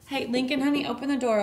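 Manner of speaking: ordinary talk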